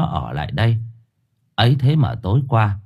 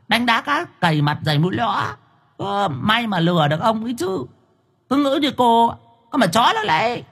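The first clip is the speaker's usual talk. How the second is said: very high, cheeky ghost voice; mock and provoke her from above; taunting ghost; 11 semitones higher, faster than usual. A narrator's reading. Ghost taunts Thơm from the treetop, very high.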